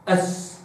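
أث breathed out unvoiced; whispered th. Shown as a demonstration. Teacher showing the letter, whispered أث. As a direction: let أث out as air only. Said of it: "هكذا".

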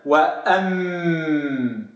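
وأم very slow, humming m; sustained m. Shown as a demonstration. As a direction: hold the m in وأم. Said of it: "دي الساكنة اهو".

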